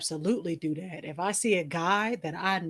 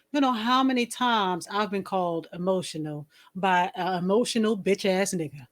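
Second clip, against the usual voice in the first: ordinary talk, nothing marked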